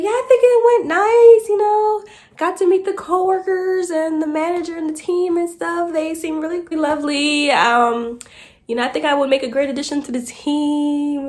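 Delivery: high, sweet professional phone voice; polished phone voice. Retold or imitated. Answering the recruiter on phone, high polite voice.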